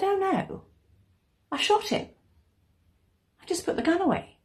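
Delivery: high-pitched, offhand neighbour; neighbour's casual confession. A narrator's reading. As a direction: voice her well up in pitch, untroubled.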